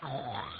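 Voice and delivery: long rushing whoosh of a charging army; imitated crowd roar. A narrator's reading. Storyteller mimics troops surging up the mountain, rushing roar. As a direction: voice a surge of soldiers.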